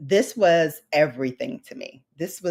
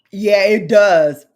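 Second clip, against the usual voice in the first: ordinary delivery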